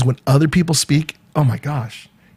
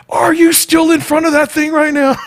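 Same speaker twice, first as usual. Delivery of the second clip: squeaky, much higher voice breaking into laughter; mock husband, high and laughing; 14 semitones higher, 4 dB louder than usual. Husband walking in on his dancing wife, high, laughing mock disbelief.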